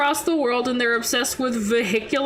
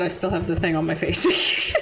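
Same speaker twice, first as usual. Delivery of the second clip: ordinary talk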